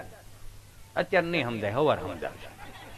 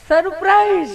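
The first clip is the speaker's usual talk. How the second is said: coy teasing; 12 semitones higher, 5 dB louder, slower than usual. Retold or imitated. Wife teasing husband.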